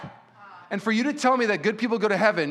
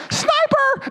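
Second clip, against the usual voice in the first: high shouted call, much higher and louder; yelled warning; 6 dB louder than usual. Retold or imitated. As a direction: bellow it like a war cry as you run. Boy yells after throwing.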